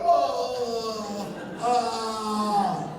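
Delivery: long drawn-out death cry; hammed-up dying wail; mock dying groan. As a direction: play-act getting shot. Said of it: "I have to die".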